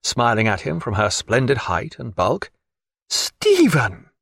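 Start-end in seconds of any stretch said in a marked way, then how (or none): 3.1-4.1 high-pitched call of his name; delighted cry. A narrator's reading. Diana running in to Stephen, crying out his name. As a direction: cry out in greeting as you run in. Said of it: "cried Diana, running in".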